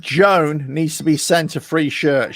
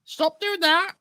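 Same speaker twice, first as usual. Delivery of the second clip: higher, softer scolding mum voice; mum telling off; 11 semitones higher, 6 dB quieter, slower than usual. Retold or imitated. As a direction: tell him off like his mum. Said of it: "She says".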